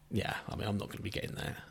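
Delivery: soft breathy chuckle while talking; laughing under breath. Real, not acted. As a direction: mutter it through a quiet laugh. Dismissive aside about the game.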